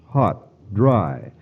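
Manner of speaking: usual speaking voice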